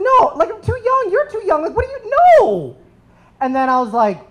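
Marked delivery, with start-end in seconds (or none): 0.0-2.8 put-on much higher, scoffing girl's voice with a laugh; imitating the girl. Girl telling him they are too young, high and scoffing. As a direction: squeal it like a dismissive preteen. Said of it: "And she's like".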